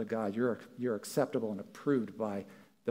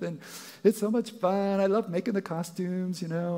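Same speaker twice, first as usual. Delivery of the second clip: lightly voiced gushing parent, higher; imagined churchgoer; 6 semitones higher than usual. Imagined congregant gushing to the pastor, a light mock gush. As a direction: gush about the kids' costumes. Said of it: "there are other people who are like".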